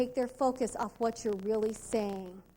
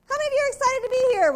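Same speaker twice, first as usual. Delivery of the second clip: excited hostess call; 10 semitones higher, 5 dB louder, much faster than usual. Retold or imitated. Hostess revving up the crowd.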